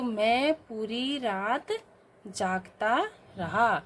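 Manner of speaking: very slow, every word spaced out for the class; slow dictation. Shown as a demonstration. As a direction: dictate it slowly. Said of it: "तो". (धीमा श्रुतलेख)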